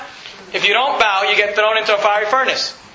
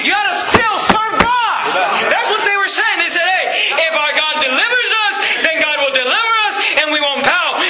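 higher, shouted preaching that builds to a climax; preaching at full force; 6 semitones higher than usual. Acted. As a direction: drive the point home to the congregation.

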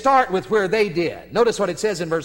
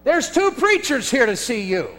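calling out; 6 semitones higher, 3 dB louder than usual. Retold or imitated. Grandson calling back into house.

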